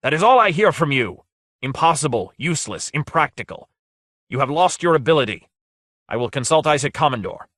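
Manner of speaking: angry tirade. A narrator's reading. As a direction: scold him with mounting contempt. Lord berating the wizard.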